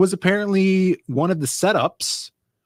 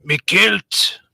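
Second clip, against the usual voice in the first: louder, higher put-on Scottish "Me kilt"; hammed-up Scottish brogue; mock Scottish; 5 semitones higher, 4 dB louder than usual. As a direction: throw on a silly Scottish voice. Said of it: "You say, like, kilt".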